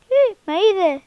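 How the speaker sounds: high, loud yelp of alarm; real startled cry of being spotted; startled shout. Real, not acted. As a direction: blurt it out as you're caught. Neighbor spots him in game.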